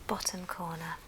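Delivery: normal talking voice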